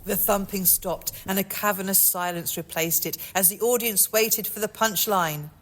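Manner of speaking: usual speaking voice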